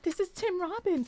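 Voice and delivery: imitated awestruck squeak; retold freak-out. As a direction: act out freaking out under your breath. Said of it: "And I'm freaking out".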